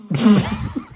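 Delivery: high burst of real laughter. Real, not acted. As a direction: let out a sudden laugh. Laughs at dad joke.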